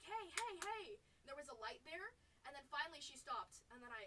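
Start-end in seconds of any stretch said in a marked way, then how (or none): 0.0-0.9 retold shout.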